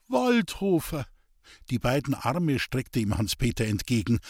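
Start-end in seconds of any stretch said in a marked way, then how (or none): 0.1-1.1 high, relieved cry of a name; eager greeting. Acted. Old man calls out to the arriving neighbour, high and eager. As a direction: reach out to him with relief.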